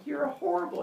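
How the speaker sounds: high-pitched accusation; accusing townsperson. Retold or imitated. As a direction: imitate villagers turning on someone. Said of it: "townspeople turn on him".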